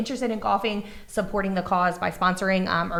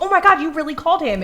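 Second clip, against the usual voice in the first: higher, louder amazed question; retold surprise; 9 semitones higher, 8 dB louder than usual. Mom learns son phoned the boss, louder surprise. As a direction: act shocked he really called. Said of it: "And I was like".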